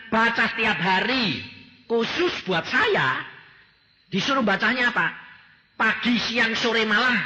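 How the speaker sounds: high, loud preaching voice; forceful preaching. Acted. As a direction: drive each word home to the congregation. (khotbah keras dan tinggi)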